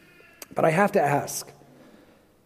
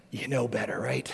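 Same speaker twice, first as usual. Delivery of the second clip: whispered aside with a laugh, mocking; sarcastic whisper; 8 semitones lower, 12 dB quieter than usual. Preacher mocking the know-it-all murmurer, sly whisper. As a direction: breathe it like a smug little secret.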